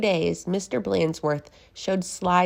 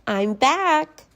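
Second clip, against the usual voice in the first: high, bright teacher voice; cheery teacher; 10 semitones higher, 5 dB louder than usual. Acted. Miss Nelson greets her class, high and cheerful. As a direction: sing out a greeting. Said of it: "she said".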